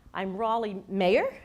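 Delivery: name given as a question, rising on "Mayer"; demonstrated uptalk on "Mayer"; upward inflection. Shown as a demonstration. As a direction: lift "Mayer" as if unsure of it. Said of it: "women who introduce themselves".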